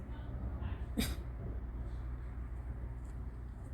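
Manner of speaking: soft unvoiced disbelieving laugh; airy laugh under the breath; breathy laugh. Real, not acted. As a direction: exhale a quiet stunned laugh.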